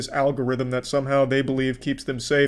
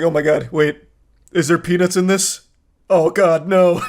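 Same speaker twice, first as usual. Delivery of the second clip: mock panic; 4 semitones higher, 6 dB louder than usual. Panicked over peanuts in food.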